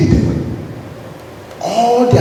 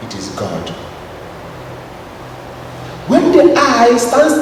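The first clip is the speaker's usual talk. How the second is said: solemn refrain; 3 semitones higher, slower than usual. Acted.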